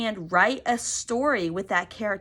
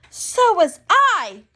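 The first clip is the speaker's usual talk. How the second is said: put-on much higher, louder, slow child; Trixie's voice; 9 semitones higher, 10 dB louder, slower than usual. Trixie to Sonia, high loud gleeful child. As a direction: squeal it out gladly. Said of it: "Trixie replied".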